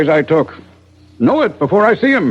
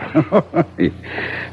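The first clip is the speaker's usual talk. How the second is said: hearty chuckle; 3 semitones lower, 7 dB quieter than usual. Acted. Doctor laughs at the question.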